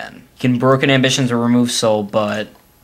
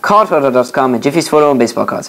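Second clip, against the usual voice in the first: brisk, louder sponsor announcement; ad read; 4 dB louder than usual. Acted. Reads the show sponsors.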